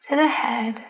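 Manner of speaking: unhurried, calm meditation voice; guided meditation. Acted. Leads a Yoga Nidra relaxation.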